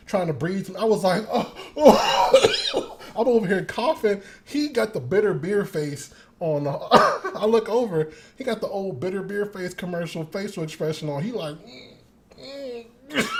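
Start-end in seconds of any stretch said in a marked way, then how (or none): 1.3-3.0 high, loud choked "oh, oh"; alarmed gasps. Retold or imitated. Retold choking on smell. 11.6-13.3 pinched hum. Retold or imitated. Dad's bitter face sound.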